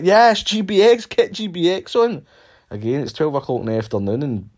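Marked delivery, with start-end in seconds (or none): 0.0-2.3 high, loud, slow party cheer; mock cheering. Mocking the partygoers cheering for the drink, loud and high. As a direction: cheer it like a drunk crowd. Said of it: "everybody else is like".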